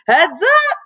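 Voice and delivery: high, loud sudden yelp. A narrator's reading. Drummer warning classmates as the stick flies, yelped. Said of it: "she yelped".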